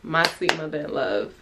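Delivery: normal talking voice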